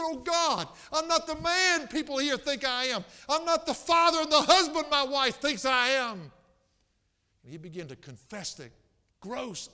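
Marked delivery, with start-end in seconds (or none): 0.0-6.3 high, loud reenactment of an anguished prayer; impassioned imitation of a man crying out; crying out in confession. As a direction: scream the confession out to God as he did.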